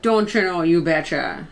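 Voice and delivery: put-on Minnesota twang; Minnesota accent. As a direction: throw in a mock Minnesota accent. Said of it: "More Minnesota".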